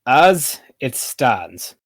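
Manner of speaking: very slow, drawn-out words; slow drunken pronouncement; tipsy city ruler. Acted. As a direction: stretch each word like a dignified drunk. Tipsy Pythor repeats himself.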